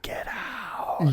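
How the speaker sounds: hushed ghostly mock warning; eerie whisper. Haunted house speaking after he hangs up, eerie whisper.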